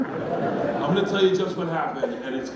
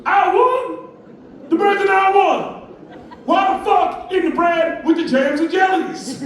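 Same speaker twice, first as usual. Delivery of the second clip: high, loud incredulous shouting; outraged shopper; 14 semitones higher, 8 dB louder than usual. Acted. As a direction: holler in disbelief at the clerk. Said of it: "And he goes".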